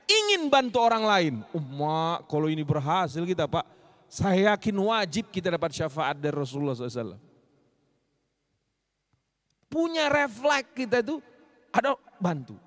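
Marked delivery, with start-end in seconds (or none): none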